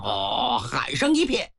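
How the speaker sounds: plain reading voice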